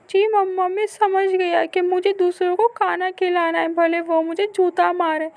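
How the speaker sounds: put-on high, loud complaining child; hurt little boy. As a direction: run to mother with the hurt. Boy answers. (माँ से ऊँची आवाज़ में शिकायत करता बच्चा)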